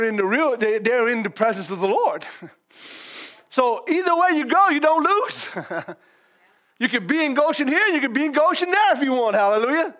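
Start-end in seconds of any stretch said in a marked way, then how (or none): 3.5-8.0 high, raised preaching cadence; fervent church preaching; preaching at full force. Acted. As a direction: lift it like a revival sermon.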